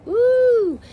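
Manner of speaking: high, loud "woo"; excited whoop. Real, not acted. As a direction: let out a sharp whoop. Exclaims about past anger.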